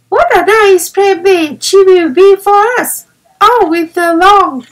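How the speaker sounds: eager young girl playing in the snow; child delighted with her new playmate; little girl Violet. A narrator's reading. Violet speaks in story.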